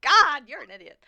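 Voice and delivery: exasperated outburst. Acted. Player acting out scorn at someone in the crowd, loud exasperation. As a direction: burst out on the first word. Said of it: "'Cause I'm like".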